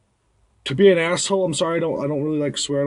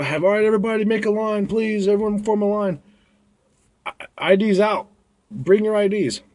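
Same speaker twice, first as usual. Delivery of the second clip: calling out; 6 semitones higher than usual. Retold or imitated. Bouncer calls out to crowd.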